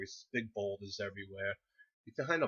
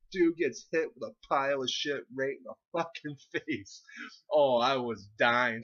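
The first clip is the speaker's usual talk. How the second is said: loud retelling with laughs breaking through; laughing excitement; 5 semitones higher, 6 dB louder than usual. Real, not acted. Telling how Scotty got hit in the face, laughing hard. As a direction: relive the funniest thing, cracking up.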